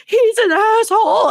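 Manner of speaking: high, loud outburst; drunken overreaction. Retold or imitated. Drunk woman complaining as friends get her out, high loud outburst. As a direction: imitate an overreacting drunk heckler's outraged cry. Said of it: "she clearly had been drinking too much".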